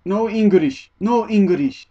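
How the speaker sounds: put-on high, loud Japanese-accented English; mock flustered Japanese accent; Japanese-accented refusal. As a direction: imitate a station worker refusing in a Japanese accent. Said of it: "And he went".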